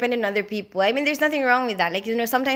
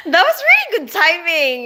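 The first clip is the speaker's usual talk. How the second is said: delighted; 8 semitones higher, 7 dB louder than usual. Real, not acted. Laughing at viewer's timing.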